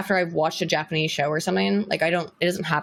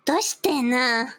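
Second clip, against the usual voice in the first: put-on anime girl voice; 5 semitones higher than usual. Cute voice continuing bit.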